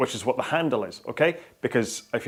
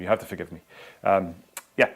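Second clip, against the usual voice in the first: normal talking voice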